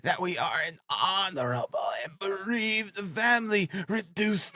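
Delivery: high, overwrought, drawn-out pleading; distraught widow. A narrator's reading. Frantic widow explaining her begging plan, distraught. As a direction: declaim it wildly at a high pitch, stretching key words.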